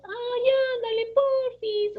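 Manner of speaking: very high, wheedling please; too high-pitched pleading. Shown as a demonstration. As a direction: beg sweetly in a squeaky, put-on voice. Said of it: "como demasiado agudo".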